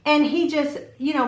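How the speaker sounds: normal talking voice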